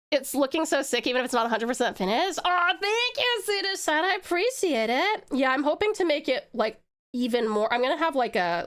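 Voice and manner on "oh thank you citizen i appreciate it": character voice. Acted. Character thanking chat.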